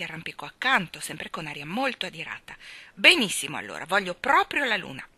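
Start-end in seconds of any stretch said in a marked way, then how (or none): 2.9-5.1 put-on loud, defiant child's cry; shouted defiantly. A narrator's reading. Michael shouting at Mary Poppins, loud and defiant. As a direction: fling it at her in defiance. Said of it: "gridò queste parole".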